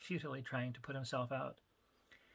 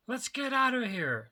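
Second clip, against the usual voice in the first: slow, loud, high yell over the noise; yelling to be heard over the din; demanding shout; 10 semitones higher, 7 dB louder than usual. Acted. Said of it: "Rigashi demanded".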